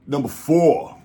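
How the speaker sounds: high, loud countdown call; excited showman announcing a number; countdown announcer. Real, not acted. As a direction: belt out the next ranking.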